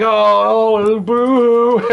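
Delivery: high, slow, hammed-up blubbering; mock crying. Retelling the game's crying victim, in a mock high boo-hoo. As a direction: mimic a victim sobbing pathetically, for laughs. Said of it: "and he's crying".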